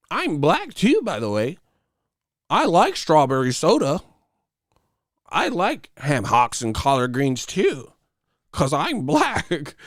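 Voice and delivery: high, exaggerated put-on voice. Poser listing foods to prove himself, high mocking voice.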